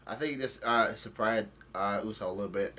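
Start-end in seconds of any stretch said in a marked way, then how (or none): none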